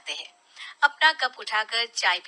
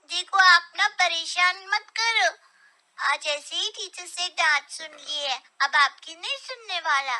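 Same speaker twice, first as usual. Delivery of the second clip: put-on a cross little boy wanting his TV; sulky child; 5 semitones higher, 5 dB louder than usual. Son sulking at father over TV time, childish.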